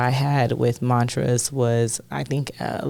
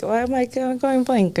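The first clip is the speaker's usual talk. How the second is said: flustered; 11 semitones higher than usual. Retold or imitated. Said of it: "I'm like".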